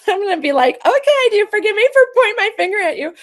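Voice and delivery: high, loud talk through real laughter; laughing. Real, not acted. Joking about meeting God when she dies, laughing hard. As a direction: laugh while speaking, pitch shooting right up.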